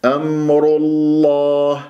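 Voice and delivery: "أمر الله" chanted slowly with full, heavy ra and lam; slow tajweed "أمر الله", both ra and lam emphatic. Shown as a demonstration. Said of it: "الراء راح أنطقها مفخمة".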